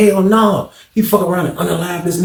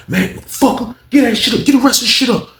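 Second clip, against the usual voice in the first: angry demand; 10 semitones higher than usual. Retold or imitated. Unc demanding the drugs.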